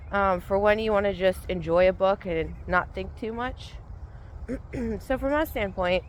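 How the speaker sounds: ordinary talk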